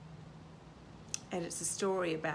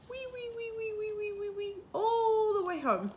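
high, thin run of wees swelling louder on the way home; squealing wee-wee refrain; 13 semitones higher, 3 dB louder than usual. A narrator's reading. Reader voicing the little roo running home, high squealing refrain. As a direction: squeal it high, then swoop down to finish.

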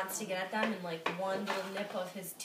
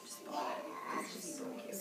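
whispering; 8 dB quieter than usual. Real, not acted. Whispered aside.

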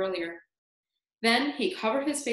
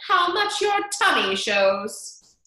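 high, loud, drawn-out jibe; loud, high-pitched taunt; crying out; 8 semitones higher, 7 dB louder, slower than usual. A narrator's reading. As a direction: call it out slowly to mock a rival. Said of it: "Aunt Spiker cried".

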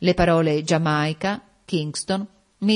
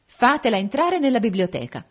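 higher-pitched haughty order; imperious; 7 semitones higher, 4 dB louder than usual. A narrator's reading. Bianca orders the servant about the library, imperious. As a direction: command the servant, allowing no objection. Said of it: "ordinò imperiosamente il bell'angelo".

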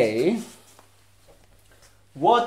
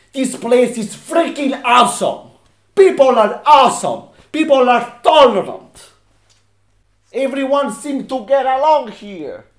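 raised, loud, insistent declarations; emphatic praise; 5 semitones higher, 5 dB louder than usual. Acted. Ex-con telling staff their workplace is great, loud emphatic delivery. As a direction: hammer each point home with force.

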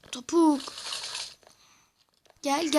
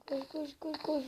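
whispering; 13 dB quieter than usual. Real, not acted. Hiding from monsters.

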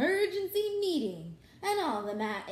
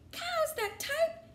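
disbelieving; 4 dB louder, slower than usual. Acted. Farmer's disbelieving question.

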